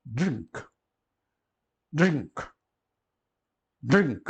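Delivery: "drink" in one syllable, short i then ng-k; English pronunciation. Shown as a demonstration. Teacher modelling the English example word, crisp one-syllable "drink". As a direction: say "drink" the English way, ending on a bare k. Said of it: "aqui dá o exemplo da palavra inglesa".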